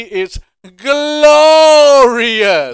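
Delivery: hammed-up wrestling promo, slow and booming; Bobby Roode. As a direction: stretch it like a wrestler's entrance catchphrase. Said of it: "to quote Bobby Roode in N X T".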